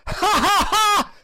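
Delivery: very high, loud witch's cackle; shrieking hag laughter; ghastly cackle. A narrator's reading. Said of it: "writhed in ghastly merriment".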